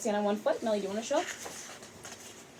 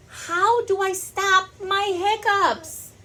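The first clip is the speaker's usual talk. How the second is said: kitty voice; 10 semitones higher, slower than usual. Acted. Pete's question in the story.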